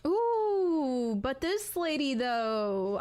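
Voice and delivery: high, loud thrilled ooh; excited ooh. Real, not acted. Streamer admiring a witch skin in the shop, thrilled ooh. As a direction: gasp with delight at a new find. Spotting a skin she likes.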